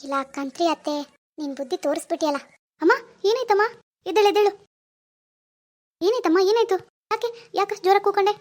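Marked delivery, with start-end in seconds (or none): 2.8-8.3 loud, high, urgent questions; raised voice, worried and pressing; alarmed. Acted. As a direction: rush to a fallen mother in alarm. Son rushes to fallen mother.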